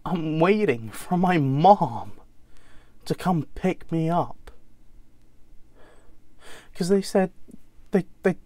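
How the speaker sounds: American son growing agitated, stumbling over the words; hurt, insistent American accent, building then falling away. Acted. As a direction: relive the wait in a strained American accent. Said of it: "James will be in an, an American accent".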